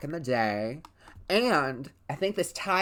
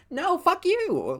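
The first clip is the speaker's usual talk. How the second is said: high, slow, sharp fuck you; angry retort; 4 dB louder, slower than usual. Retold or imitated. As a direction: spit it back at them, much higher. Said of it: "she was like".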